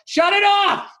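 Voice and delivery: loud, high-pitched shout to cut it; retold crowd yelling. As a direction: yell it out like an appalled audience. Retold crowd demanding it stop.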